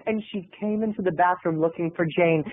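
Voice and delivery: ordinary talk, nothing marked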